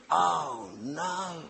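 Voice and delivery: slow, sinking "oh no", hushed at the end; dismayed. Retold or imitated. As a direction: let dread land softly. Dismayed at the food on offer.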